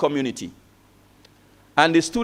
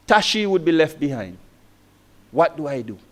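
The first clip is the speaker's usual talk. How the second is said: usual speaking voice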